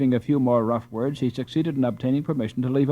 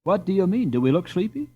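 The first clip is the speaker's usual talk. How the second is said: mock indignant; 7 semitones higher than usual. Karl mocking the innkeeper.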